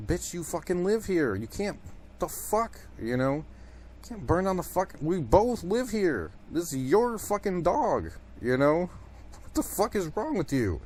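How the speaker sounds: retold outrage, voice climbing high; exasperated mock rant. To a girlfriend trying to burn the house down, high exasperated protest.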